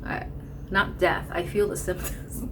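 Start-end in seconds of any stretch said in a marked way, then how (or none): none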